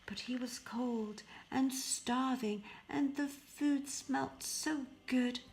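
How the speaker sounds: usual speaking voice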